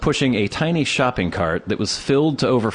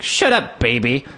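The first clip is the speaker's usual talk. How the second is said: put-on taunting bully kid. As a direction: sneer it like a bratty boy. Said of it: "His companion ... like".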